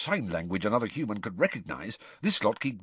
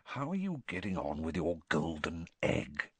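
low, hushed, confidential; lowered voice; 4 semitones lower, 7 dB quieter than usual. A narrator's reading. Bagman privately asks Harry about the egg, in a lowered voice. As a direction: drop your voice to ask discreetly. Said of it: "he lowered his voice".

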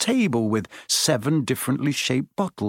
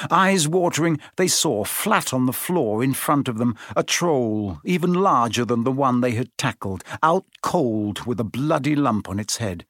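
usual speaking voice

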